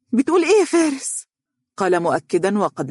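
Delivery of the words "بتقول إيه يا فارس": put-on high, stunned young woman's question; disbelieving. Dunya hearing Faris was rejected, stunned and disbelieving. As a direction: cannot believe what she hears. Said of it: "هتفت دنيا غير مصدقة".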